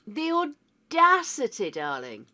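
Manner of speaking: imperious duchess, nose in the air; old duchess. Acted. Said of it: "You can sound like an old duchess".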